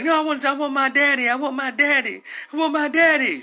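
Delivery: high, loud repeated wail; imitated child's insistent pleading; screaming child. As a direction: cry out like a desperate little girl. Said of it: "She kept saying that".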